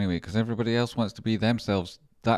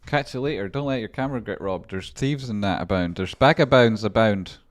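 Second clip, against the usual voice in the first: booming jokey farewell, higher and much louder; loud playful calling out; 5 semitones higher, 10 dB louder than usual. Real, not acted. Calling after a departing friend, loud and playful. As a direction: bellow a mock warning after him.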